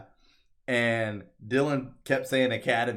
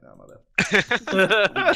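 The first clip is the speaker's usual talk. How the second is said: laughing apology; 8 semitones higher, 5 dB louder, much slower than usual. Retold or imitated. Fast talker retells apologizing, laughing.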